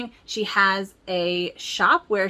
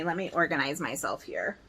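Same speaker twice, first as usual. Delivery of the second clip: ordinary talk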